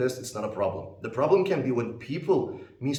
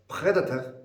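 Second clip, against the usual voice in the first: "predator" higher, with a French throaty R; French accent; 4 semitones higher than usual. Shown as a demonstration. Teacher imitating tourists from France, French-accented "predator". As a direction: say "predator" as a French speaker would. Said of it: "if they say the word predator as".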